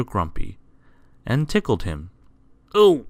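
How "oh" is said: high, loud startled cry; alarmed squeak. A narrator's reading. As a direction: squeak as if suddenly tickled. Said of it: "squeaked Mister Grumpy in alarm".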